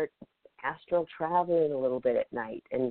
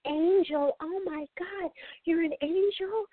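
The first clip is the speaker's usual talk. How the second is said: very high squealing gasp of wonder; ecstatic disbelief; slower than usual. Retold or imitated. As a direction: shriek it like the best news of your life. Spirit reveals itself as angel.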